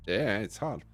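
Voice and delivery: ordinary talk, nothing marked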